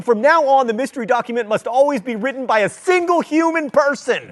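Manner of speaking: loud, high-pitched indignant demand; exasperated outburst. Real, not acted. Host protesting the mystery document's author, exasperated and loud. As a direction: raise your voice and lay down the law. Outraged at a wrong guess.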